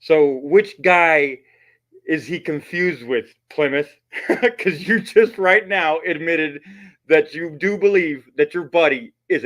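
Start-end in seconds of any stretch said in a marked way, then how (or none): none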